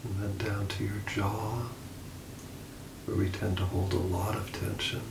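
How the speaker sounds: soft, unhurried meditation voice with long pauses; calm, hushed guide easing listeners toward sleep; guided meditation. Acted. Leads a guided body scan.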